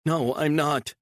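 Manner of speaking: high pleading female voice; frightened woman. A narrator's reading. Captive woman pleading with her kidnapper, high and frightened. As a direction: beg him, scared and small.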